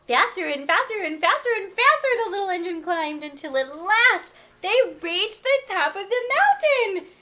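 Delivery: fast, high, loud storytelling; thrilled, rushing narration; building excitement. A narrator's reading. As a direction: race breathlessly up to a triumphant peak. Narrates climb to the top.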